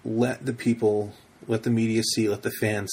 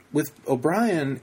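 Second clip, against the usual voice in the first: usual speaking voice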